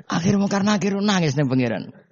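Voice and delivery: high, giggly telling; high amused narration; laughing narration. Real, not acted. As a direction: narrate while still laughing. Still laughing while narrating.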